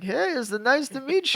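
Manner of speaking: lightly voiced, cheery fighter greeting; super friendly George. George Saint Pierre greeting a stranger on the bus, super friendly. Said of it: "he's, like, super friendly".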